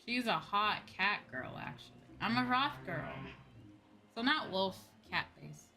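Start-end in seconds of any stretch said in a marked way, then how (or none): none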